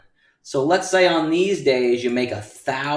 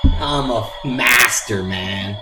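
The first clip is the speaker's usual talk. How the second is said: loud, mock-proud boast; hammed-up bragging; 3 semitones higher, 7 dB louder than usual. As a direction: brag like a show-off. Bragging about his discovery.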